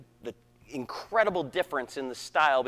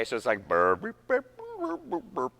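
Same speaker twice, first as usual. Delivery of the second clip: imitating players honking out wrong notes; mock burps; 3 semitones higher, 5 dB quieter than usual. Director mocks the band's missed pitches. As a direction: mimic sloppy burping notes. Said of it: "Some people are missing pitches".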